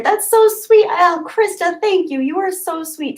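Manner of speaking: high, bright-eyed thanks; high excited thank-you; delighted gush. Real, not acted. Thanking a viewer for a referral.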